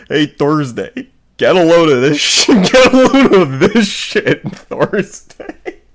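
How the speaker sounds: loud, high, drawn-out mock call; hollering. Austin turns to Thursday to show off, hammed-up holler. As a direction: holler it across the room, cracking up.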